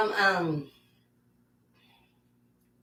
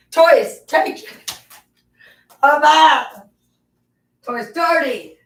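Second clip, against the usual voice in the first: higher and louder, cooing at the dog; sing-song pet voice; 8 semitones higher, 10 dB louder than usual. Real, not acted. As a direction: croon it to a muddy pup. Baby talk to the dog.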